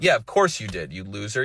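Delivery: usual speaking voice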